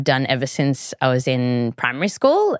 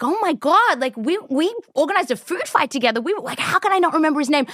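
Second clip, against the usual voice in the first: higher, fast, frantic self-scolding; exasperated disbelief; 10 semitones higher, faster than usual. Retold or imitated. Retelling to co-host her year of not remembering a friend's name, exasperated and fast. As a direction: rattle it off, baffled at your own memory.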